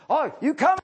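lightly voiced radio huckster, high and loud; a hint of a pushy radio pitchman; mock radio ad. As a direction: hawk freebies like a radio ad. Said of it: "every advertisement on any radio program".

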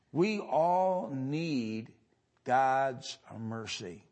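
very slow, each word weighed; slow, solemn stress on all; slow emphasis. Real, not acted. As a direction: let it sink in slowly. Preacher repeats line for emphasis.

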